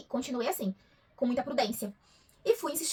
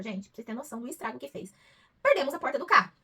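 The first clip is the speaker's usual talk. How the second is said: ordinary talk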